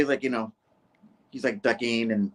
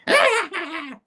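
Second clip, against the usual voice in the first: high-pitched staccato cackle; character's laugh. Acted. Executive at press conference joking about his own laugh, high cackle. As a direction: burst into a high, showy laugh. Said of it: "especially not my laugh".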